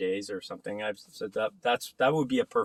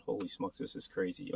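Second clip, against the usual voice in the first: stunned whisper; 2 semitones lower, 9 dB quieter than usual. Retold or imitated. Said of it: "I said".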